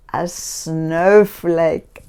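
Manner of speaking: ordinary delivery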